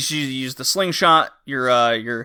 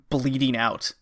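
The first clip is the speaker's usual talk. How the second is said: ordinary talk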